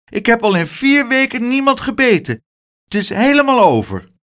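high, insistent little voice; high-pitched young character, pleading; earnest child. A narrator's reading. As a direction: promise it like an eager child. Child insists biting is over.

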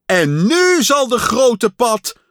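high, loud grand announcement; announcer voice. A narrator's reading. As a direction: proclaim the star to the hall. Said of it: "Toen zei de stem".

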